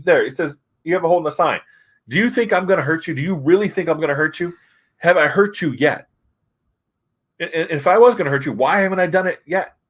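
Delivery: high-pitched retold indignant plea; acted pleading. Acting hero's plea to protesters.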